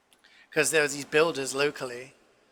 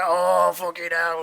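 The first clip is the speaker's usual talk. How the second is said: drawn-out, louder mock exasperation; exasperated customer; 3 semitones higher, 5 dB louder, slower than usual. Man who wanted just a salad, exasperated. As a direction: groan it out like a fed-up customer. Impatient customer quits the queue.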